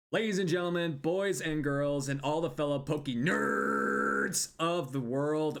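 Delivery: slow, big showman welcome; announcer intro. Acted. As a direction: boom out the opening like a host. Opens the podcast like announcer.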